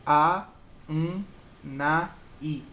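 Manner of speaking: "A, um, na, i" as four detached beats; separated syllables. Shown as a demonstration. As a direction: pause between the sounds of "A, um, na, i". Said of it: "as sílabas são separadas".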